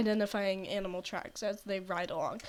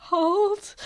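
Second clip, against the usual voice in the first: sharp, high, loud call of a name; urgent shout; 9 semitones higher, 7 dB louder than usual. Retold or imitated. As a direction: yell a warning to the mentor. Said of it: "and he's like".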